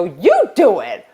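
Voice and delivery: worked-up, indignant comeback; retold angry snap; angry retort. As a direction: throw the order back angrily. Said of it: "I'm so worked up and I'm so angry".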